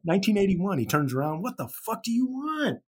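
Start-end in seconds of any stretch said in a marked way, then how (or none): 1.4-2.8 high-pitched retort, not shouted; mock startled anger. Startled star turns around on fans, mock angry. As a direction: replay a startled outburst at ordinary volume. Retelling the startled star's reply.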